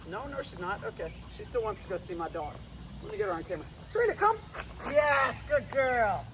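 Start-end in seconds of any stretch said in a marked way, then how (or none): 3.9-6.3 high happy call then praise; high cheerful praise for the dog. Real, not acted.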